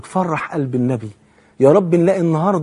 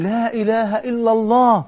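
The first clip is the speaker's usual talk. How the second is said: slow, raised, drawn-out proclamation; high, measured recital of an alarmed cry; solemn alarmed warning; 5 semitones higher, slower than usual. Retold or imitated. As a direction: stretch each word like a grave warning. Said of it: "فدخل النبي فزعا وهو يقول".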